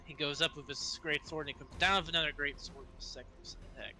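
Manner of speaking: ordinary talk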